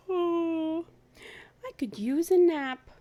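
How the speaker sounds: high, loud yawning sigh; sleepy Amos. Acted. Amos yawning to his friends, high and sleepy. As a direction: let it out like a big yawn.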